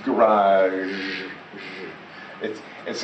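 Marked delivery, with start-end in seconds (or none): 0.0-1.2 "Garage" with a long buzzing zh at the end; drawn-out zh ending. Shown as a demonstration. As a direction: slowly model "Garage", holding the final zh. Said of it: "but it's a zh".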